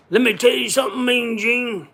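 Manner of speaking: high, loud wrestler promo voice; Hulk Hogan. Acted. Wrestler addressing the interviewer, high loud put-on voice. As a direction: belt out a hammed-up wrestler impression. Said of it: "I can't do a good Hulk Hogan".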